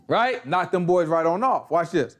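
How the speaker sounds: ordinary talk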